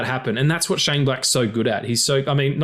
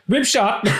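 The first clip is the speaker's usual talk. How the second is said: shouted correction; 10 semitones higher than usual. Acted.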